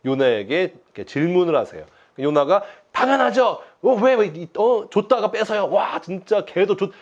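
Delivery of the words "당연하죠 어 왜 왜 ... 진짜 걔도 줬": defiant talking back. Retold or imitated. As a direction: sulkily talk back to God like a cheeky kid. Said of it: "하나님한테 막, 응? 막 대드는 거예요".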